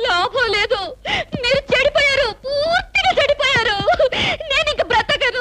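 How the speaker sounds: high, tearful wail of despair; melodramatic crying. Acted. Wife wailing at husband over his cigarette, tearful. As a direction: sob it out like the world is ending. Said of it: "నువ్వు మరీ అలా ఏడుస్తుంటే".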